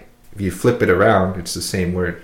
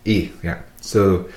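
ordinary talk

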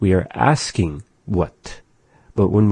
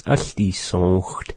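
slow model phrase; slower than usual. Shown as a demonstration. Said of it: "let's begin with our phrase".

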